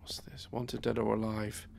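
breathy whisper under the breath; hushed reading aloud. Real, not acted. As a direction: whisper it to yourself. Whispering while reading in game.

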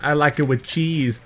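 ordinary delivery

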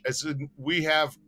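ordinary talk, nothing marked

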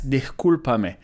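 "Discúlpame" with the s breathed as H; aspirated S. Shown as a demonstration. Learner noting the show's accent, aspirated s in "Discúlpame". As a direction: say "Discúlpame" as dih-kulpame. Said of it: "it's an aspirated S".

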